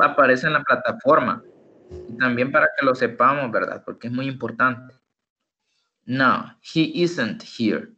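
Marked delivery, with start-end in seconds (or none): 6.0-7.9 slow "he isn't here"; slow model. Shown as a demonstration. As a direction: model it slowly. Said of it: "Y la otra forma". (forma lenta con "isn't")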